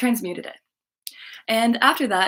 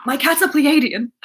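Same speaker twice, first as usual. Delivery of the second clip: loud, high-pitched sudden burst; imitated blurting; 6 semitones higher, 7 dB louder than usual. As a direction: let it spill out uncontrollably loud. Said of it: "looks at me and word vomits".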